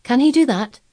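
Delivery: high, loud eager question. A narrator's reading. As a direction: ask it breathless with eagerness. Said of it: "he asked eagerly".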